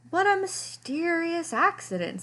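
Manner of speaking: high, faux-innocent wonder; mock innocence. A narrator's reading. Lester pretending the shrunken sweater was an accident, mock-innocent. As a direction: feign surprise, all innocence. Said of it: "said Lester".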